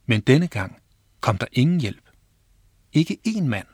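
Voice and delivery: ordinary delivery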